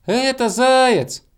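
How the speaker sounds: high, drawn-out loud call; squirrel shouting. A narrator's reading. Squirrel shouting down from the star, high long call. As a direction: shout it down from far above. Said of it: "крикнула со звезды белка".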